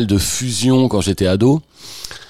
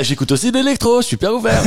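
much higher, mock-boastful teenage swagger; mocking teen self; 12 semitones higher than usual. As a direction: send up your smug younger self bragging. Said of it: "et donc je dis, ah".

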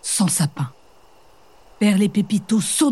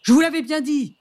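exclaiming; 7 semitones higher than usual. Acted. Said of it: "s'écrie le bûcheron".

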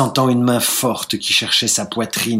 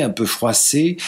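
ordinary delivery

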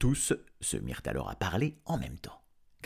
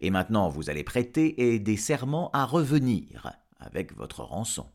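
raised, loud official proclamation; pompous rector, stern; 8 semitones higher, 5 dB louder than usual. Acted. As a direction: pronounce solemn terms to a guilty dragon. Rector delivers terms to dragon. (recteur solennel, voix haute et forte)

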